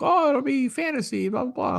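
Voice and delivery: high teasing imitation of the girls; mocking girls' voice. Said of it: "they're like".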